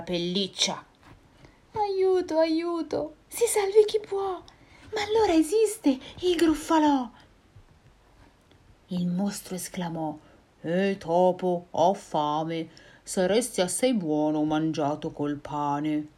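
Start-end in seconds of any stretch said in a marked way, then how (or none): 1.7-7.1 very high cries for help; panicked mouse. Acted. Mouse meeting the real monster, high frightened squeals. 10.6-16.1 low, slow monster; hungry monster voice. Acted. Gruffalo telling the mouse he'll eat him, low.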